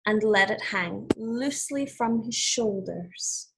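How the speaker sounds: put-on clear, measured read-aloud for children; storybook reading voice; story narration. A narrator's reading. Teacher reads fable aloud.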